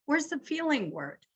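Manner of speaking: high, quick mock astonishment; mock surprise. Counselor teasing a vague partner, hammed-up surprise. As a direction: pretend to be startled, pitch jumping up. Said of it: "I go".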